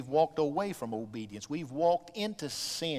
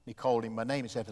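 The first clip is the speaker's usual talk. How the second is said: normal talking voice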